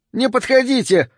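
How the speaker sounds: very high vagrant's squeal; взвизг. A narrator's reading. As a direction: yelp to keep them back. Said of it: "снова взвизгнул бродяга". (взвизгнуть высоким голосом)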